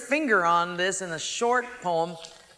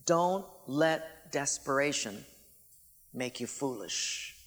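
lower, much softer and slow; hushed emphasis; 5 semitones lower, 6 dB quieter, slower than usual. Real, not acted. Preacher driving home his warning to the congregation, hushed and slow. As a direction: lean in and lay it down gently. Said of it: "Now listen carefully".

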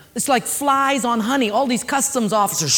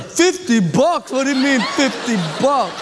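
outraged Dominic. Retold or imitated. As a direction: drag out the outrage at the fine. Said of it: "You know how Dominic stands up".

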